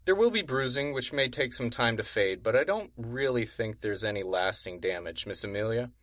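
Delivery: a hint of a soothing doctor. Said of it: "because he's a doctor".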